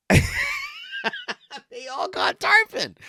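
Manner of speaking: high, slow laughing shout of joy. Real, not acted. Excited about friends' catch.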